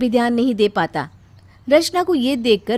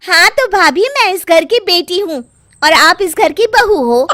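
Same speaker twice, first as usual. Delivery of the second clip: higher, louder, laughing jibe; taunting with a laugh; 9 semitones higher, 9 dB louder than usual. Acted. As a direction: rub it in smugly. Daughter taunts sister-in-law.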